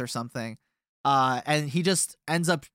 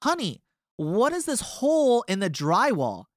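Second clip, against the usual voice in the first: a hint of a higher wife voice, lightly voiced; wife's question; 7 semitones higher than usual. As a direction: lightly play the puzzled wife. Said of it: "she goes".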